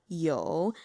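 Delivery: "yo" low with vocal fry; third tone fry. Shown as a demonstration. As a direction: drop "yo" into a creak. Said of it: "that vocal fry".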